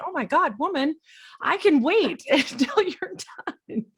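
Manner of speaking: high, incredulous protest with laughter; mock exasperation. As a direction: playfully scold a friend, laughing. Retells her amazed protest to guest.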